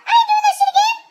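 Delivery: high, loud, laughing yell; laughing shout. Real, not acted.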